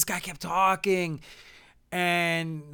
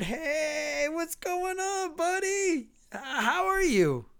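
high, drawn-out chummy hello; standard fake greeting; 11 semitones higher, much slower than usual. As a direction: bluff warmly at a higher pitch. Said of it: "you just do the standard".

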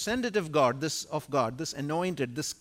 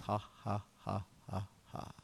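God's mocking laughter; 5 semitones lower, 8 dB quieter than usual. Preacher voicing God laughing at the mutineers, soft mocking laugh. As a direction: laugh down at the rebels quietly. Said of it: "God's response to such mutineers and disloyal ones is".

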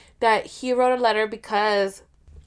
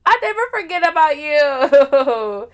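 loud, higher, hammed-up declaration; mock declaration; 5 semitones higher, 6 dB louder than usual. Her teasing him about being forgotten, loud and hammed-up. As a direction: belt it out playfully at him.

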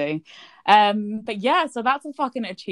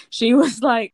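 normal talking voice

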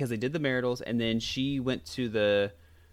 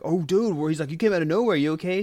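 startled driver; 5 semitones higher, 3 dB louder, faster than usual. Retold or imitated.

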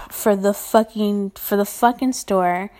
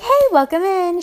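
cheery greeting; 9 semitones higher, 5 dB louder than usual. Retold or imitated. Greeting a customer at the store.

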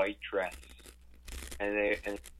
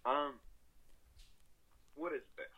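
ordinary talk, nothing marked

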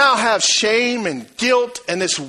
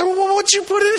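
disgusted son; 11 semitones higher than usual. Retold or imitated. Boy demands answer.

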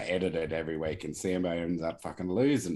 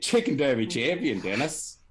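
loud, higher champion introduction; announcer intro; 7 semitones higher, 5 dB louder than usual. Acted. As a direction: present him like a title fight.

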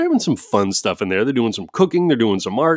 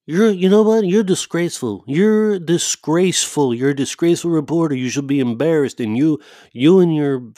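mock scolding president berating a reporter; hammed-up Trump, indignant and accusing; Donald Trump voice; 5 semitones higher, 3 dB louder than usual. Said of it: "I don't even wanna do his goddamn voice".

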